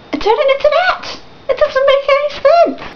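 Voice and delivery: high-pitched incredulous complaint; exasperated disbelief. Real, not acted. Fan ranting about a ruined game, exasperated. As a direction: protest it, pitch shooting right up.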